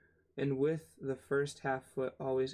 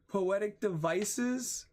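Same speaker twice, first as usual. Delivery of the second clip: higher and louder, exasperated list reading; climbing exasperation at the list; rising disbelief; 8 semitones higher, 3 dB louder than usual. Real, not acted. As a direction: read each name more incredulous.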